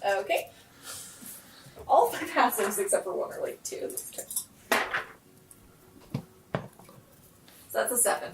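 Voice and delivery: usual speaking voice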